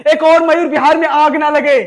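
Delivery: loud outburst. Acted. Officer shouts at the major about the riots, loud. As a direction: hurl it at him.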